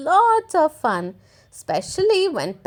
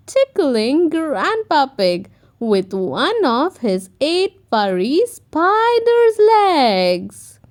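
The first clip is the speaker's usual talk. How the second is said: ordinary delivery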